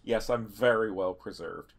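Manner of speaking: normal talking voice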